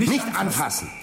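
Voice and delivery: high, sudden alarm, stop him touching it; urgent shout to keep hands off the coil; sharp warning cry. Acted. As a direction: snap out a panicked warning. Warns brother off the field.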